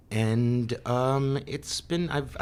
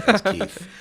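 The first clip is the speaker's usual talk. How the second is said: Keith impression; 10 semitones higher, 6 dB louder than usual. Mimicking broadcaster Keith's catchphrase.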